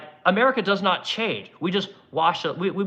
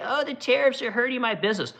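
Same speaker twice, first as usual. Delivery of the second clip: high-pitched mocking whine; mock complaining; 7 semitones higher than usual. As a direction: parody a feeble complainer. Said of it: "when the pussies come out and say".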